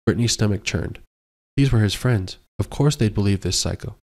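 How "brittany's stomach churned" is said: ordinary delivery, nothing marked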